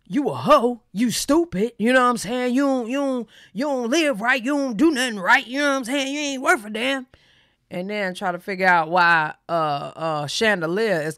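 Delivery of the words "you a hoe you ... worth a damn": higher-pitched mock rant; mocking men's insults. Imitating men insulting a woman, mocking and higher-pitched. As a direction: imitate a man running a woman down.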